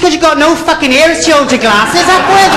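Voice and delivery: high, emphatic punchline; comic high voice. Acted. Job applicant answering the airline boss, high and emphatic. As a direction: drive it home up high.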